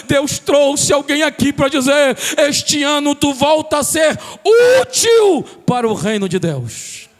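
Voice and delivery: loud, high, fervent preaching over music; full-force preaching. Acted. Pastor proclaiming to the church, loud and fervent. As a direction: preach it out to the congregation.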